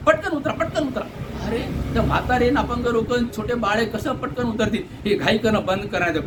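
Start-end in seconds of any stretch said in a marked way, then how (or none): 0.1-1.0 high mock call to get off quickly; conductor's rushed mock order, pitched up; hurrying conductor. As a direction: play the impatient conductor hustling people off. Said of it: "आणि उतरताना सुद्धा".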